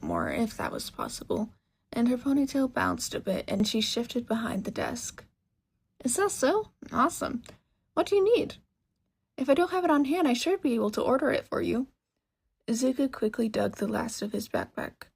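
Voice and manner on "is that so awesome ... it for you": high, excited woman's voice; bubbly shopkeeper. Acted. Mechanic woman welcomes a young customer, bubbly and high. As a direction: gush at the customer eagerly.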